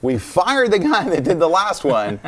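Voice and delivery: mock studio phone call, high and drawn out; studio caller. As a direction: play the caller loudly, slowly, with a laugh nearby. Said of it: "So if DreamWorks calls and says".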